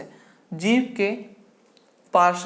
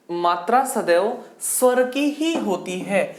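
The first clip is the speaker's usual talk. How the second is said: slow stress on ही in मात्रा सदैव स्वर की ही होती है; deliberate teacher emphasis, slowed right down; slow emphatic rule. Shown as a demonstration. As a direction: spell out the rule word by word. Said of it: "देखना, हमारी बात समझना".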